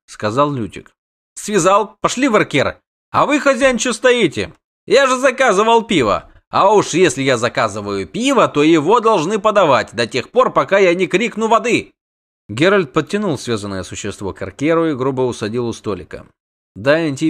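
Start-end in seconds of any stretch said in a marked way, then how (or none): 1.3-11.9 high-pitched, demanding complaint; indignant bard. A narrator's reading. Bard scolds innkeeper for not serving beer, indignantly.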